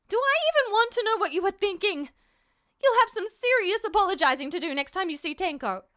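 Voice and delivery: high, loud tearful tirade; angry scolding mother. Acted. Mother berating son about his brother, loud and upset. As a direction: scold through held-back tears. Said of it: "There were tears in Mom's eyes".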